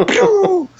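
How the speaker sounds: high quick "pew" zoom effect; a cartoon speed sound effect; telling how his wife bolted off, with a high zip sound effect; whoosh sound. Wife bolting out of the bakery.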